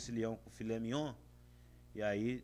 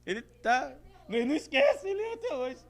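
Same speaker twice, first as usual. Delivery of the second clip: excited; 9 semitones higher, 6 dB louder, faster than usual. Acted. Preacher mocking a stingy man.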